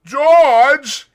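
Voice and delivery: loud, high cry of terror; Lenny crying to George in terror; terrified cry. Acted. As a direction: cry out for help. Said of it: "he cried".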